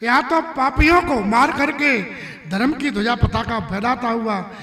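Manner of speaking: high, loud, rousing command; forceful exhortation. Acted. Kunti sends Arjun word to slay sinners, rousing and forceful. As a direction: urge the warrior on at full voice.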